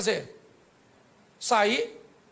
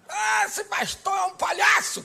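high, drawn-out mock-outraged cry; hammed-up indignant squawk; mocking critics; 11 semitones higher, 3 dB louder, slower than usual. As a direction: mimic scandalized critics in a high, slow voice. Mimics critics in evangelical circles.

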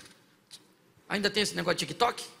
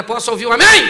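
loud, ringing call; calling for amen; 3 semitones higher, 15 dB louder than usual. Acted. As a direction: shout it to the whole church. Calling the congregation.

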